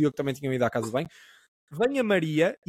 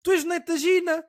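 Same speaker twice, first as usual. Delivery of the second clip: high, louder exclaimed realisation; sudden recognition; 10 semitones higher, 3 dB louder than usual. Retold or imitated. Zé Rui recognising the narrator at the café, in a high surprised exclamation. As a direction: voice him suddenly placing you. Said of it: "ele vira-se e diz".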